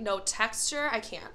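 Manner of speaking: ordinary delivery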